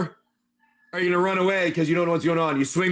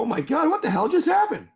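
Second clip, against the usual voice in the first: much higher, a hammed-up stunned exclamation; mock shocked player; 9 semitones higher than usual. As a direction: act out a startled hero in disbelief. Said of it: "You know".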